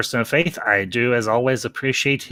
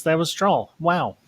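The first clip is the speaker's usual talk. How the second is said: normal talking voice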